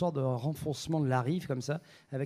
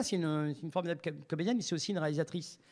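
usual speaking voice

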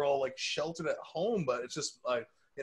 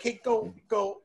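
mock shooing; 9 semitones higher, 4 dB louder, slower than usual. Acting out people being kicked out.

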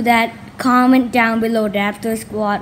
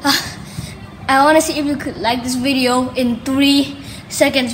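usual speaking voice

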